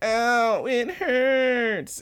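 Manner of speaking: very high squeaky ow; mock girly whine. Haruko lands hurt under Sasaki, in a very high mock whine. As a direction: whimper it up high like a hammed-up girl. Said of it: "she's like".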